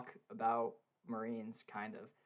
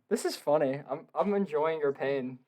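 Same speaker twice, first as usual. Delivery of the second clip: normal talking voice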